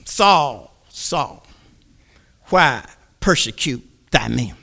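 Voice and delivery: low, softened heavenly voice; lightly voiced, solemn divine call. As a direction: call gently from above. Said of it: "and the voice said".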